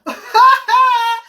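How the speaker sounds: a high, loud real laugh; burst of laughter. Real, not acted. Host laughing at Tonya's comment, loud high laugh.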